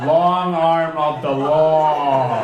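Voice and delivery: loud, very slow mock proclamation; booming projection. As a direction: stretch and belt out each word. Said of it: "you project really well".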